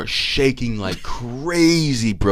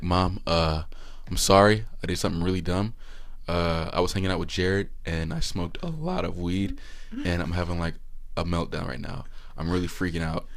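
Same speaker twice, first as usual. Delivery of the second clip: softer, sheepish, halting confession; scared confession; 5 semitones lower, 8 dB quieter than usual. Retold or imitated. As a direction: own up to mom like a scared kid. Confessing to mom at night.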